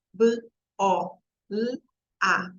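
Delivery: "B, O, L, A" as four separate sounds: buh, open o, lll, ah; phoneme by phoneme. Shown as a demonstration. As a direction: sound out "B, O, L, A" with pauses, no letter names. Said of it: "Quantos fonemas". ("B, O, L, A" fonema por fonema, com pausas)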